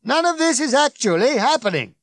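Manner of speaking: loud, high-pitched crowd control; blaring public announcement; bellowing. A narrator's reading. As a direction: shout officious reassurance over everyone. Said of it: "Ford continued to bellow".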